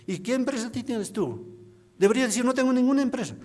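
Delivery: high, rushed, exasperated telling-off; scolding wife. Retold or imitated. Wife scolding her husband over the company lie, exasperated. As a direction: fire it off at him, fast and fed up.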